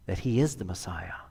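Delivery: hushed, low, awed possibility. Acted. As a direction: drop to a near whisper. Hushed suggestion of a startling possibility.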